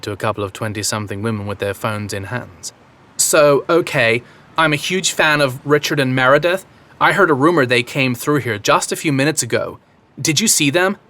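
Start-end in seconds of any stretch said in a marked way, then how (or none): 3.2-11.0 put-on Valley girl American accent; fake American accent. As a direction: Bostonian trying a California accent. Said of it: "decent approximation of an American accent".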